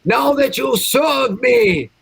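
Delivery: high, slow booming orders; commanding shout. Acted. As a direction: roar commands at your servant. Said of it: "I'm gonna tell him".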